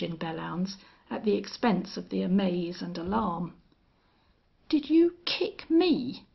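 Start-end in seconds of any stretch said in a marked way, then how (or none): 4.7-6.2 high, slow outraged shout, held back in volume; indignant shout. A narrator's reading. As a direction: raise the pitch and stretch out the outrage. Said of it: "he shouted".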